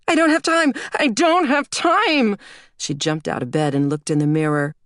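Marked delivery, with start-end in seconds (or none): 0.1-2.4 very high, loud frantic wail; panicked girl. A narrator's reading. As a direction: wail like a twelve-year-old running late. Panicked girl late for school.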